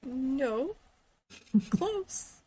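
laughing reply, high and bright. Retold or imitated. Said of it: "And I'll be like".

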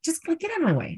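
high-pitched, exasperated mock outburst; retold frustration. Retelling being mad at friend after class, high exasperation. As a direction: act out fed-up impatience, pitched high. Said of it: "I got so mad".

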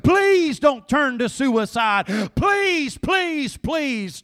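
loud, pleading preacher's cry; full-force preaching plea. Acted. Preacher pleading with the congregation not to despair, loud and urgent.

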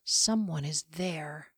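breathy unvoiced whisper, slowed; a slow, tense whisper of dread. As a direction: hush it as if someone might hear. Sensing a hidden presence.

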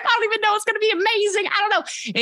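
squealing high with excitement; bubbling over, voice shooting up; excited. Real, not acted. Gushing to the interviewer about her trip, high and excited. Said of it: "I'm getting all excited".

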